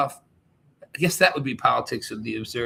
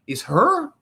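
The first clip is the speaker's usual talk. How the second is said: ordinary talk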